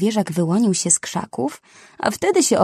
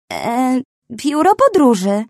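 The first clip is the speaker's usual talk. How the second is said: higher, louder startled blurt; flustered rhino blurting an answer; 6 semitones higher, 5 dB louder, slower than usual. A narrator's reading. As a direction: stammer it out, caught off guard. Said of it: "wykrztusiła".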